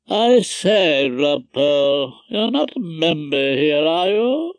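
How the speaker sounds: slow, drawn-out interfering judge; Justice Vosper. A narrator's reading. Judge challenging Rumpole's right to be there, officious. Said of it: "Mister Justice Vosper always had to have his two pennyworth".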